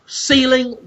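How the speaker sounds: ordinary talk, nothing marked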